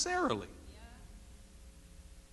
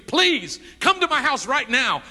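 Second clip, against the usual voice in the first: higher and louder, a begging cry; desperate plea; 6 semitones higher, 6 dB louder than usual. Acted. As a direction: beg him frantically to come now. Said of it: "started trembling and screaming".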